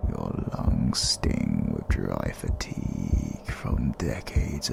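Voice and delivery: menacing incantation. Acted. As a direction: breathe each word of the curse at the victim. Villain drains victim's life force.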